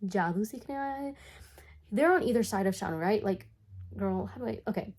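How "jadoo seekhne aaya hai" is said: high mocking bully voice; higher-pitched condescending question. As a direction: imitate kids taunting the newcomer in a put-on voice. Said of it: "in a really condescending tone".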